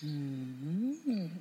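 low greedy hum; lip-licking hum. Acted. Cat eyeing the bird, low greedy hum. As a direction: hum like savouring a meal. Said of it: "said the cat, licking his lips".

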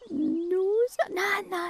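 high, loud protesting no. Acted. Woodpecker stopping cuckoo from crying again. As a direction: cut her off before the tears.

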